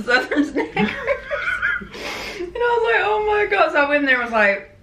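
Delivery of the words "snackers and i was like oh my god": high-pitched laughing retelling. Real, not acted. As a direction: crack up while telling the story. Laughing over a funny mishap.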